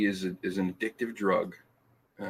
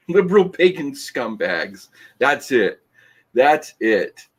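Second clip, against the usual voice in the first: mock outraged; 5 semitones higher, 8 dB louder than usual. Reads insult from comment aloud.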